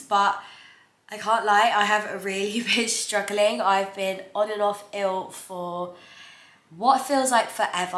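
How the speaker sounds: ordinary delivery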